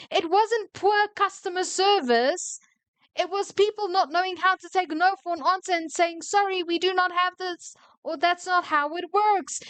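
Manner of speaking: indignant high-pitched scolding; angry shouting. Real, not acted. Scolding an employee for blaming staff, shouting angrily. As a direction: defend the staff in a high, heated rant. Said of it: "why I'm shouting".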